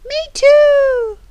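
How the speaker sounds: high, loud, eager pipe-up; eager plea. Acted. Asking for a bun as well, eager plea. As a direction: call it out high, stretching the last word.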